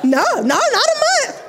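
high, fast teasing refusal; mock denial. Doctor correcting the patient's guess of a month, mocking.

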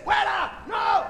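high, loud mock-frightened yells; panicked shout. Disciples fend off a ghost with oars, hammed-up panicked shout. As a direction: shoo it away in exaggerated fright.

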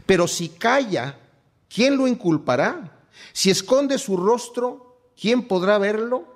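solemn scripture reading, drawn out; lectura bíblica solemne. Acted. Reads scripture aloud, raised. (lectura solemne y pausada de las Escrituras)

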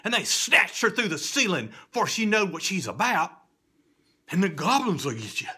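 dramatic loud climax. A narrator's reading. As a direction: burst out with the snatching. Goblins snatch girl.

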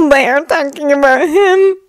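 a lightly voiced teenage boy; young boy voice. Izuku voiced by narrator.

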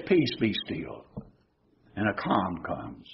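a hushed calm after the storm; hushed peace be still. Acted. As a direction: let the storm fall silent under the words. Jesus calming the storm.

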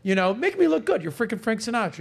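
high, loud eager pleading; Don Rickles coaching Frank. Retold or imitated. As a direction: pitch it up like an excited pal asking a favor. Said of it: "and he goes".

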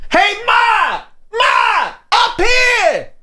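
high-pitched New York holler. Acted. New Yorker calling Ma from a window, hollering. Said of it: "hollering out of a New York window".

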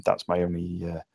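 normal talking voice

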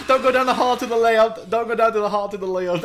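high, loud, repeated alarm; urgent warning. Real, not acted. Player warning teammates off the hallway, urgent and loud.

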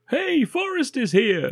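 high, slow cheering bystander; mock extra shout. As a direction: call it out like a dubbed extra. Mocking extras shouted off camera.

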